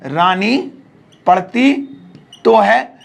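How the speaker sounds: "रानी / पढ़ती / तो है" in separate pieces; word by word. Shown as a demonstration. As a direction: pause after "Rani" and after "पढ़ती". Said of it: "जैसे मैंने कहा".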